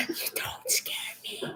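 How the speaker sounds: laughing into a whisper; whispering back. Pretend.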